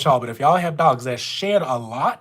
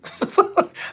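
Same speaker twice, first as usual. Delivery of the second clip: breathy laugh. Real, not acted. Laughing at her Louisiana pronunciation.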